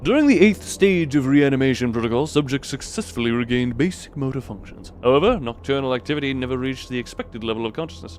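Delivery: measured lab report narration; documentary read. Acted. Streamer reading the game's lab notes as a report. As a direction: read it like a grim research log. Reads in-game research log.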